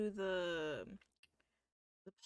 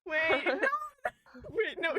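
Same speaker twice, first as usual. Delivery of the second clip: laughing protest; 8 semitones higher, 9 dB louder, slower than usual. Real, not acted.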